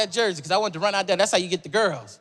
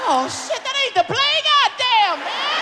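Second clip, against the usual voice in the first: shrill, frantic high-voiced cursing; high panicked babble; 4 dB louder, slower than usual. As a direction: squeal in panic about the play. Panic as the ball comes.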